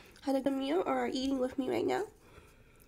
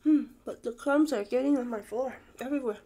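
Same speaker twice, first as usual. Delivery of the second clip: talking between bites; chewing; 5 dB louder, faster than usual. Real, not acted. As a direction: mouth partly full. Seen 1: bit food at -7s; lips pressed, jaw working between words.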